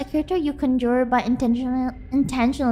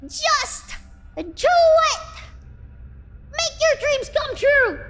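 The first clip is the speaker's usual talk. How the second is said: high, drawn-out motivational yell; over-the-top rallying cry; mock motivational shout; 13 semitones higher, slower than usual. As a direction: belt out a hammed-up pep talk, much higher. Acting out motivational catchphrase.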